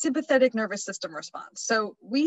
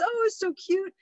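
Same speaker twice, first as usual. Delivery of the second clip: cooing; 10 semitones higher than usual. Retold or imitated. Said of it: "Sarah says".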